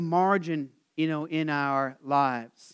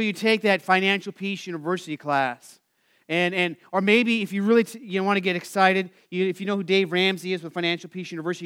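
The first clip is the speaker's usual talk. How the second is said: ordinary delivery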